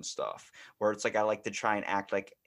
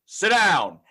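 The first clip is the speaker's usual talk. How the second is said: booming command; 8 semitones higher, 11 dB louder than usual. Retold or imitated. Imitating a booming Chicago friend, loud command. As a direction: boom it out so the room obeys. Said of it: "he goes".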